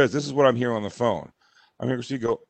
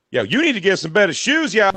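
higher, sassy put-on voice; passing lady; 6 semitones higher, 4 dB louder than usual. Passing lady scolds wife about shoes, put-on higher voice. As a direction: toss it off as a mock street heckle. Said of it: "there's a lady just goes".

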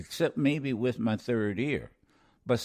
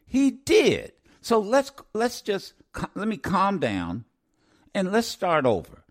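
angry; 3 semitones higher than usual. Real, not acted. Host berating a caller who missed the point, angry. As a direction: vent at the caller, barely holding back. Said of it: "the reason I'm angry".

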